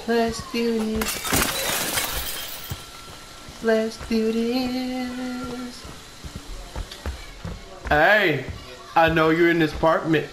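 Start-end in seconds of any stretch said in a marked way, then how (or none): 0.1-5.8 very slow, quieter, dragged-out threat; slow creepy killer drawl; drawn-out menace. Pretend. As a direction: stretch each word out like a stalking killer. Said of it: "repeated, ever slower".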